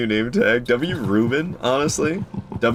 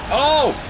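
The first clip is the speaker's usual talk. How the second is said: sudden high-pitched "oh"; startled cry; 13 semitones higher, 3 dB louder than usual. Real, not acted. Player caught by a one-shot attack, crying out. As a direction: yelp as the attack lands.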